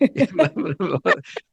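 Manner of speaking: a short high burst of laughter; amused laugh. Real, not acted. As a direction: laugh at the question. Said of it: "Oh, God".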